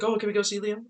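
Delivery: a light girlish imitation; imitating her. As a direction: mimic her innocent request. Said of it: "says, like".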